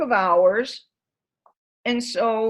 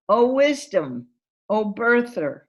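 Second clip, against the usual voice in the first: prayer reading; 2 semitones higher, 6 dB louder than usual. Shown as a demonstration. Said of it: "I'm gonna read it to you".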